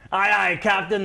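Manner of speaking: light pirate crewman voice. Pretend. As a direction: answer like a deckhand. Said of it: "Talk Like a Pirate Day".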